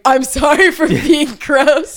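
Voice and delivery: high, slow, laughing mock apology; sing-song fake sorry, laughing; mock apologetic. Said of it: "my story time being like".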